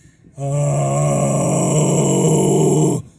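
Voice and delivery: loud breath release; long pushed airy sigh. Shown as a demonstration. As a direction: blow it all out as air. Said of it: "turn it into just air".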